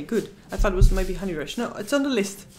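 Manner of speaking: ordinary delivery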